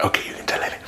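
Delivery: whispered okay. Retold or imitated. Letting his friend tell his wife, whispering. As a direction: whisper the permission. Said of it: "And I was like".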